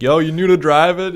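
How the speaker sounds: high, loud heckle; hammed-up loud challenge; mock Boston local. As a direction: holler it like a brash local, half laughing. Friend parodying the local.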